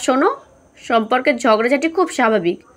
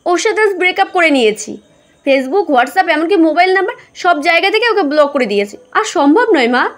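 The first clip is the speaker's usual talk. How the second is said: higher, louder daughter fed up and done; angry daughter announcing the breakup, pitched up; a little angry; 5 semitones higher, 4 dB louder than usual. Acted. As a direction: snap back at mum, hurt and sure. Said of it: "একটু রাগ নিয়ে স্নেহা বলে ওঠে".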